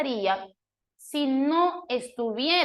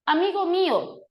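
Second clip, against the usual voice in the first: higher, louder, moved cry of amigo mío; exclaimed with emotion; 7 semitones higher, 3 dB louder than usual. Shown as a demonstration. Teacher reads the quote as an exclamation, higher and louder. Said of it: "si no estuvieran los signos de exclamación".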